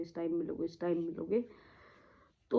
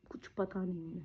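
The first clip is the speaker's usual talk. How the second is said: hushed whisper; 7 dB quieter than usual. Real, not acted. Describing father unconscious.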